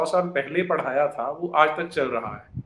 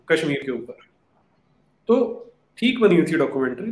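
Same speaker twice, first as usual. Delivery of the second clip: usual speaking voice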